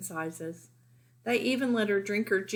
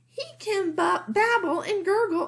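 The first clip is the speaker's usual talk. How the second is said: high, bright-eyed proud brag; proud boast; 11 semitones higher, 4 dB louder than usual. A narrator's reading. Lily boasting about her baby brother, proud and high. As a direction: show him off proudly.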